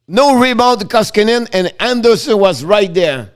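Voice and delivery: excited play-by-play. Acted. Live play-by-play call.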